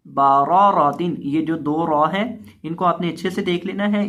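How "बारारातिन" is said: बारारातिन said slowly with both ra full; slow careful reading. Shown as a demonstration. Teacher modelling बारारातिन slowly for students. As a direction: lay out बारारातिन syllable by syllable. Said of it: "इसको हम पढ़ेंगे बारारातिन".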